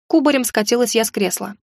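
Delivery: usual speaking voice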